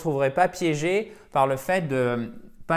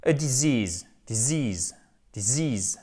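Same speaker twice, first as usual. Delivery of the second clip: disease with the stress on the second syllable, dee-ZEASE; slower than usual. Shown as a demonstration. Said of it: "accent tonique là".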